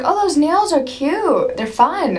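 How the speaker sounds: high, loud retold squeal of approval; gushing compliment. Retelling a star admiring her nails, gushing.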